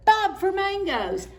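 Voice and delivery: high, loud excited call; excited answer. Acted. Family shouting ideas excitedly. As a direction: shout it eagerly.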